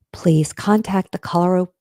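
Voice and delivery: ordinary talk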